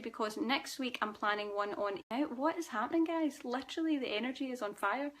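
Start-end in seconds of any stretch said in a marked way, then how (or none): 2.4-5.1 higher, livelier, what is happening guys; excited. Real, not acted. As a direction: burst of excitement to the viewers. Hyped about next week's energy.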